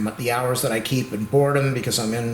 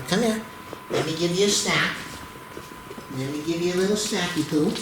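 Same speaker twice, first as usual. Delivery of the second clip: higher, sing-song treat talk; high doting pet voice; 4 semitones higher than usual. Real, not acted. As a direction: tempt her with a snack, sweetly. Offering the dog a snack.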